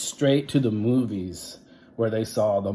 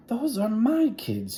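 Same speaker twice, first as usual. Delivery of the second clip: high, scratchy disguised teacher voice; 13 semitones higher than usual. Acted. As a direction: rasp it out in a scratchy voice. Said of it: "in a scratchy voice".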